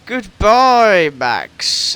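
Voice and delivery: loud taunting goodbye; gleeful mock send-off; mocking farewell. Mocking the retired driver.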